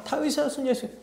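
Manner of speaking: high, soft imitated cry; calling out. Beggar keeps calling over the scolding.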